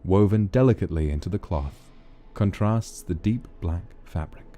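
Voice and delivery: soft, unhurried storybook reading with character lines; reading a fantasy story aloud to the chat; story narration. A narrator's reading. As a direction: read the romance scene gently, voicing each character. Reads a visual novel aloud.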